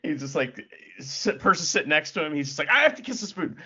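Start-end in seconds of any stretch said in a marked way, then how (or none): 2.7-3.6 high-pitched, urgent mock outburst; mock urgency. Podcaster mimicking a compulsive boot kisser, mock urgent. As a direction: blurt it high, as if compelled.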